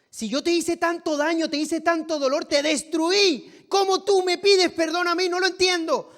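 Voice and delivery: high, loud anguished outcry; dramatized pleading at full voice, very high; distraught disbelief, acted. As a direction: cry out in bewildered self-reproach, pitched right up. Acted offender baffled at being forgiven.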